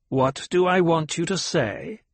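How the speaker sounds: high old woman mocking her words; mocking old matriarch; cruel mimicry. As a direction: throw her question back at her. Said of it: "The old voice carried a tone of cruel mimicry".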